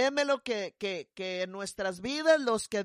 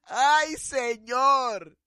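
louder, higher cry to the Lord; 4 semitones higher, 7 dB louder, much slower than usual. Real, not acted. Pastora crying out to the Lord. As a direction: call out to God. Exclamation to God.

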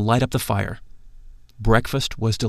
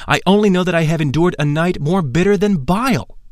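astonished outburst; 6 semitones higher than usual. Acted. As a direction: pitch up in shocked disbelief. Shocked by news of initiation.

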